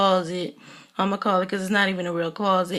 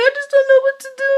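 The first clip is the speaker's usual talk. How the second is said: much higher, louder, tearful wail; mock crying; 8 dB louder, faster than usual. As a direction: ham up a breaking-down sob. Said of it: "she just started breaking down, crying".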